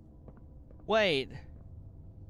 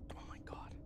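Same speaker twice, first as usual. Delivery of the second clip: whispered; 20 dB quieter than usual. Real, not acted. Breathless after escape.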